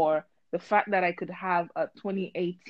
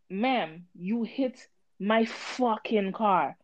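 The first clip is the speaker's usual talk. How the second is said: slow measured; 4 dB quieter, much slower than usual. Retold or imitated. Retelling calm confrontation.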